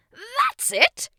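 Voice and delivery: very high, loud cheer; excited. A narrator's reading. King praises boy's attempt, excited cheer. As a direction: burst out with delight. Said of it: "grew even more excited".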